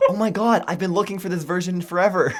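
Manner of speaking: high, loud, laughing delight; a teacher laughing with joy at a find; giddy teacher. Retold or imitated. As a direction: squeal it through laughter like a thrilled teacher. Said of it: "the teacher was like".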